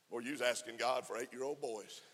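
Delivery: ordinary delivery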